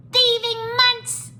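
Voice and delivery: very high, loud, drawn-out accusation; accusing gecko. A narrator's reading. Gecko accuses the monks, shrieked insult. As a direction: hurl the insult in a squeaky creature voice. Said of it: "the gecko accused".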